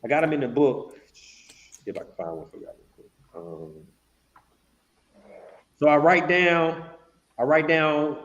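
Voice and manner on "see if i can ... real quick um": very low, whispery aside while searching; barely audible, talking under the breath; muttering to self. Real, not acted. Host to viewers while hunting for a contract, muttered. Searching for her written notes.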